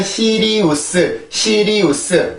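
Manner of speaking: "시리우스" in four full Korean syllables; Korean-style pronunciation. Shown as a demonstration. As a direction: spell out "시리우스" evenly as written. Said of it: "한국어로는". (한국어식으로 "시리우스"를 네 음절로 또박또박)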